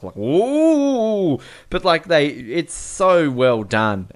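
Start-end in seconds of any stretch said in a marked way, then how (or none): 0.2-1.4 long, high swooping ooh; exaggerated, drawn-out fake excitement; mock awe. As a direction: draw it out high, sarcastically impressed. Mocks dull-sounding car park chase.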